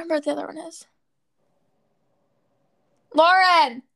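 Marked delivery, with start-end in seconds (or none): 3.1-3.8 loud call across the house. Real, not acted. Calling her sister to the room, a loud shout. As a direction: shout her name to fetch her.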